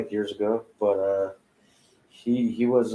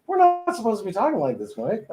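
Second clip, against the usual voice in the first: mock scolding voice; 13 semitones higher, faster than usual. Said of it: "They're like".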